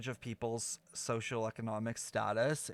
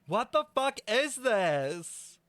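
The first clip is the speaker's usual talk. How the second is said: slow, higher, louder stunned outburst; shocked disbelief; 8 semitones higher, 5 dB louder, much slower than usual. Real, not acted. Streamer stunned by the plot twist, slow shocked outburst. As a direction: drag it out in disbelief.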